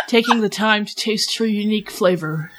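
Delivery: normal talking voice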